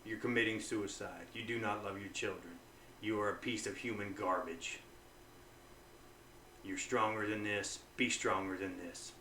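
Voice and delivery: very soft, low self-condemnation; negative self-talk. Shown as a demonstration. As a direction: condemn yourself quietly and gravely, then urge strength. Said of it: "So I'll say".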